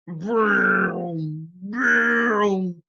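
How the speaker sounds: long, loud rising vroom; airplane vroom. Retold or imitated. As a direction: make a toy plane noise. Girl plays pencil airplane.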